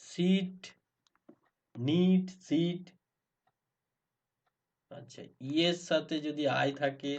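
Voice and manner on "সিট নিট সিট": raised demo words. Shown as a demonstration. Teacher calling out example words higher. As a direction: pitch each word up clearly. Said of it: "যেমন হচ্ছে নিট".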